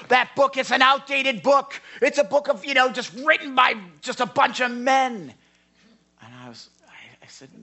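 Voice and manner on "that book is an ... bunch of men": imitated angry outburst, high and loud; loud, high-pitched mock outrage. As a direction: fire it back as an indignant heckler. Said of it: "He got more angry at us".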